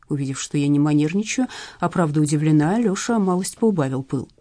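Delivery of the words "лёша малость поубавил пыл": ordinary delivery, nothing marked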